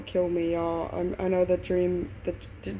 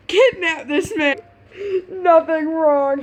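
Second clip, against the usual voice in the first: laughing; 9 semitones higher, 7 dB louder, slower than usual. Real, not acted. Reader laughing at the story.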